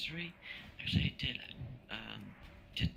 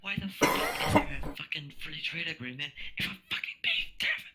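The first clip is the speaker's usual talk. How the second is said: worked-up, higher and louder outburst; exasperated, raised-voice swearing rant; angry rant; 11 semitones higher, 4 dB louder than usual. Real, not acted. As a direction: rant indignantly, pushing up in pitch and volume. Heated rant about tariffs.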